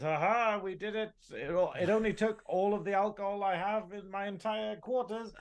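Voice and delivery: hammed-up drunk engineer crowing about his win; a tipsy triumphant Scotty, half-mocking; imitating Scotty. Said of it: "Scotty sort of goes".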